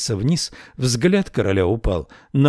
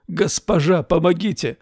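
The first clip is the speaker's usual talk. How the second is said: cry for help; 6 semitones higher than usual. A narrator's reading. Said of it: "закричал он".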